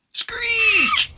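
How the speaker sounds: shrieking pteranodon cry, high and loud; high loud bird screech. A narrator's reading. Pteranodon swooping back, screeching high and loud. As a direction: screech like a diving flying reptile.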